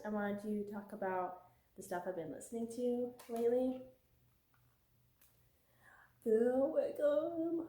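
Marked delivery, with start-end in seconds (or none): 6.2-7.7 smug joke. Acted. Joking aside after music plug.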